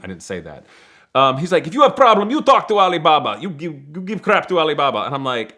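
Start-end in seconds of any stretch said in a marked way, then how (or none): 1.7-5.0 loud, higher mock accented clerk; hammed-up clerk laying down the rules; imitating the clerk. Motel clerk telling the guests to complain to Alibaba, loud mock accent.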